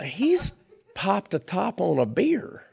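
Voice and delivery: high, swooping mock astonishment; surprised realization. Retelling his thought on overhearing Boots, high-pitched surprise. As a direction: act out a dawning discovery, pitch leaping up.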